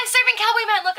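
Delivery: high, loud imitation of an eager coworker; excited boast.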